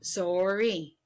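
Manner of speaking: "Sorry" drawn out as SORE-ee; deliberate SORE-ee for "Sorry"; Canadian "sorry". Shown as a demonstration. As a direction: round the first vowel of "Sorry" to a long oh. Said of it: "I do say sorry".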